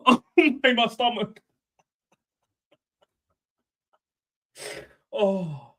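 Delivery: gasping words after laughing hard. Real, not acted. Clutching stomach from laughing.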